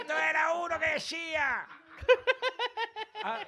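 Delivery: high shouted comedian impression; Chiquito de la Calzada. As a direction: squeal out the joke opener like a manic comic. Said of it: "A ver, ¿cómo imites a Chiquito?".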